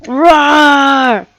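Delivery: loud, high dinosaur roar; boss roaring. Acted. Dino boss roaring down the phone at the detective, loud roar. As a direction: let out a big beastly roar. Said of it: "I like that our boss roars".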